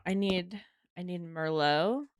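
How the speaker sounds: ordinary delivery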